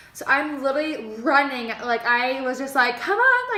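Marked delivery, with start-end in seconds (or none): 3.0-3.6 urgent plea. Retold or imitated. Running to the road.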